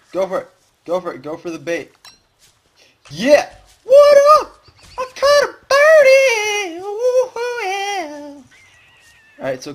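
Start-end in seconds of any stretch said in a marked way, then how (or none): 0.1-1.9 high, eager coaxing; eager urging. Real, not acted. Urging the bird. 3.1-3.5 loud, high cheer; triumphant shout. Real, not acted. Celebrating catch. 3.8-8.4 very high, slow silly squeal; squeaky silly voice. Pretend. Squeaky celebration voice.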